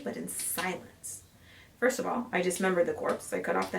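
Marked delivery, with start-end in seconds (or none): 0.0-1.2 softer, voiceless aside; whispered. A narrator's reading. Killer working in silence, whispered aside. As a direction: drop to a whisper.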